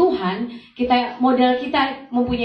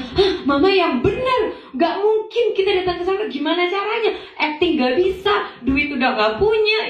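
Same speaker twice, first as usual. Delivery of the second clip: high-pitched, incredulous retelling; disbelieving protest; 7 semitones higher than usual. Retold or imitated. Daughter protesting to her mother about the casting call, incredulous. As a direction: act out a daughter's baffled objection.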